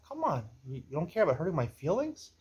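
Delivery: ordinary delivery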